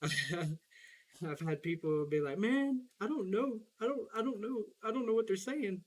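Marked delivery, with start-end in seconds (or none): 2.4-5.8 baffled complaint. Retold or imitated. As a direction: imitate someone helplessly lost by what they hear. People baffled by Southern speech, acted.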